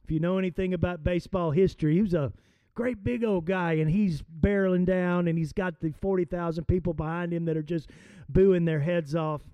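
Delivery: ordinary talk, nothing marked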